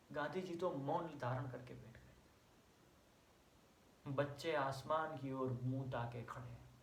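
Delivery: ordinary talk, nothing marked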